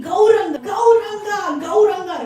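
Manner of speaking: loud, high cries of the name; screaming. Acted. Devotee by the river crying out for his Lord, screaming. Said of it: "he starts screaming".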